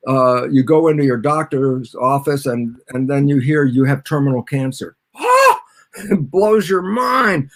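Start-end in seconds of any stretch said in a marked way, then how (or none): 5.2-5.6 very high, loud gasp of shock; mock shocked gasp. Patient hears terminal diagnosis from doctor, acted shocked gasp. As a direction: gasp as if hit by terrible news.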